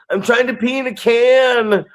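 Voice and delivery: very high, squeaky excuse; sheepish kid voice. Retold or imitated. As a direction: caught red-handed and explaining. Kid explaining himself.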